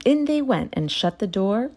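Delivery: usual speaking voice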